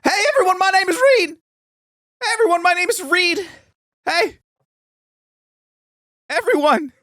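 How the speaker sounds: high, giddy Willy Wonka voice. Pretend. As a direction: play a giddy candy-maker host. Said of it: "the new Willy Wonka".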